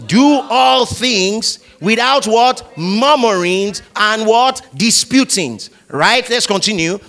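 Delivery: loud, high, slow lead reading; preacher calling out the verse; call-and-response reading. Shown as a demonstration. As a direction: lead the crowd line by line. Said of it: "Together, everybody".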